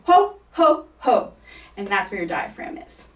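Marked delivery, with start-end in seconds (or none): 0.1-1.3 Santa Claus laugh. Shown as a demonstration. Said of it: "So they go".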